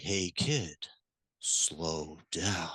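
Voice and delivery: put-on low, soft and slow, far below the kid's energy; too calm to reach a racing kid; flat low mismatch. Shown as a demonstration. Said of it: "It's too big of a mismatch".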